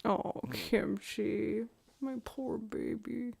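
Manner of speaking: slow, soft and sorry; slow soft pity for the cat; tender pity. Real, not acted. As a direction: linger softly over the poor cat. Pitying her crying cat.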